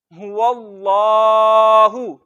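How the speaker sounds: हुवल्लाहु very slow, heavy drawn ल; heavy lam recited. Shown as a demonstration. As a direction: recite हुवल्लाहु with full thick ल. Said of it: "मोटा पढ़ेंगे". (मोटा लाम, धीरे खींचकर)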